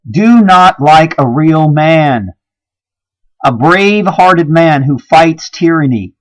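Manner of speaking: loud, slow decree of the beast; quoting the beast. Retold or imitated. Preacher voices the beast's order to women, loud and scornful. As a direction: proclaim it as the beast's command, mocking. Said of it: "beast has said".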